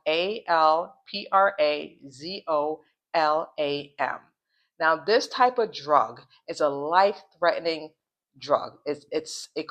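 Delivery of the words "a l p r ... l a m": slow, letter-by-letter spelling; careful, measured spelling for listeners. Real, not acted. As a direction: spell it slowly, each letter separate. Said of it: "I'm going to spell it out".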